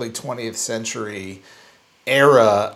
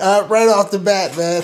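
mock British; 8 semitones higher, 8 dB louder than usual. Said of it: "Give, give us a something".

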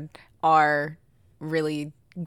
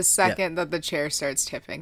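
normal talking voice

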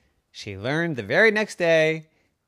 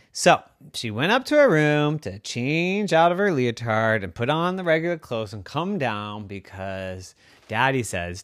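ordinary delivery

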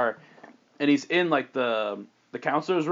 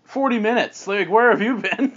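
high, louder indignant demand; scolding mother; 7 semitones higher, 4 dB louder than usual. Acted. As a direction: interrogate the late daughter crossly. Mom scolds late daughter.

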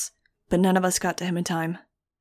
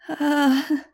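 high, queasy groan before retching; a nauseous moan; sick groan; 8 semitones higher, 3 dB louder than usual. A narrator's reading. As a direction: groan like you're about to throw up. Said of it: "Nudge groaned".

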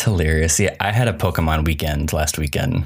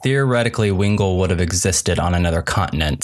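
ordinary talk

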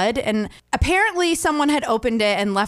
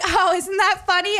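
high-pitched, breezy mock amusement; imitating mom; 10 semitones higher than usual. Mom to her wronged daughter, imitated breezy amusement. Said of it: "She was like".

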